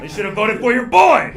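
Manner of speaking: loud, high, aggrieved boast; mock indignation. Runner-up tells crowd he was robbed, loud mock indignation. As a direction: shout it like a cheated candidate. Mock outrage at losing yearbook vote.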